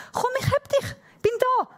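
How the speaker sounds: high-pitched imitation of a reassuring dad; acted fatherly encouragement; coaxing father. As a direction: coax a scared child, pitched high. Acts father coaxing scared child.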